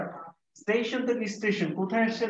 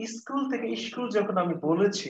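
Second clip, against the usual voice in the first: ordinary talk, nothing marked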